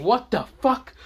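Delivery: high, loud shocked outcry; crowd scream. Acted. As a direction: shout it like the whole class at once. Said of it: "Everyone screams at".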